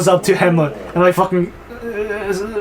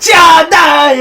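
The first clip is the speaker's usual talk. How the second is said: high, loud, hammed-up yell; mock battle cry; 11 semitones higher, 8 dB louder than usual. Hamlet striking the king, mock furious yell. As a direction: yell it as a theatrical killing blow.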